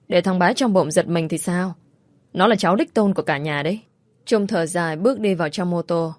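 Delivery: ordinary delivery, nothing marked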